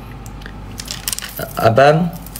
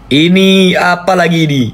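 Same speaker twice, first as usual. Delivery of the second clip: louder, slowed cry of surprise; startled loud exclamation; 7 semitones higher, 4 dB louder than usual. Real, not acted. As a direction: exclaim at the new gift in shock. New big gift arrives. (seruan kaget keras dan pelan)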